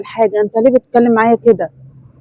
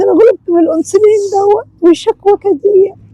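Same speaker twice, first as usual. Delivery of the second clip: put-on higher, frail old mother's complaining voice; old sick mother; 5 semitones higher than usual. Old mother complaining to her son about injections. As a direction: whine like a worn-out old mother.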